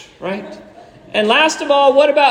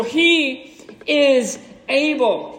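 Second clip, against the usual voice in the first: higher, very slow, word by word; emphatic proclamation; 4 semitones higher, much slower than usual. Real, not acted. Preacher declares God does the impossible, slow and emphatic. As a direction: draw out each word of the refrain.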